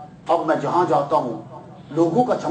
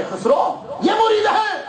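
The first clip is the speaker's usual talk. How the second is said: proclaiming shout; slower than usual. Acted. Preacher proclaims the disciples.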